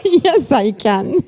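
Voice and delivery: slow, laughing agreement. Real, not acted. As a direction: chuckle through the words.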